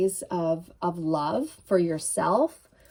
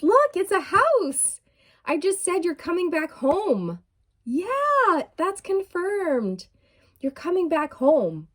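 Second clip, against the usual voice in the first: excited; 9 semitones higher than usual. Real, not acted. Card confirms her message.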